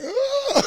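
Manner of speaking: very high playful "uh" squeal; a teasing squeak from beyond; spirit's squeal. Retold or imitated. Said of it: "she'll be like".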